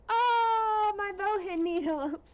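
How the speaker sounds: high, loud gush of delight; excited squeal. Retold or imitated. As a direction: re-enact an overjoyed cry, voice shooting up and easing down. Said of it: "I was so excited".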